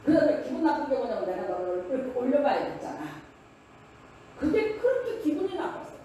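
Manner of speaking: friend's heated complaint. Retold or imitated. Friend's complaint continues, acted out.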